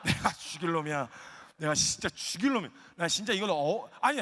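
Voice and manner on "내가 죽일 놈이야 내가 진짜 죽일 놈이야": low, mock-abject begging; pleading apology. Husband on his knees to crying wife, mock-abject pleading. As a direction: beg forgiveness, voice dropped low. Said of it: "그날 저녁에 빌고 있는 거예요".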